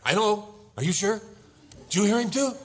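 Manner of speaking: high, loud, doubting questions; anxious husband. Acted. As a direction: press your wife in acted panic. Acted anxious husband doubting wife's reassurance.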